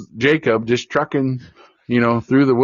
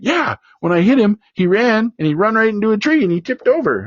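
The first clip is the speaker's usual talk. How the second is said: much higher, eager imitation of the boy; excited kid; 11 semitones higher than usual. Son telling his dad the deer fell, high excited voice. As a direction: tumble it out like a thrilled youngster.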